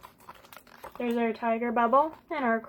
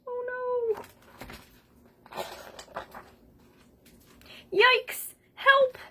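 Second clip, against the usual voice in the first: mock panic; 12 semitones higher, much slower than usual. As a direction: squeal for help like a scared cartoon. Bubble monster attacks Kangaroo.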